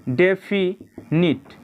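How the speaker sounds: drawn-out syllables for dictation; slow classroom dictation; slow spelling-out. Shown as a demonstration. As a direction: stretch each syllable so students can write. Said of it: "तो पहला जो होता है definite".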